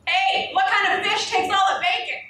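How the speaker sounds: loud, high joke to the room; loud call-out. Acted. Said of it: "I loudly engaged the dining room".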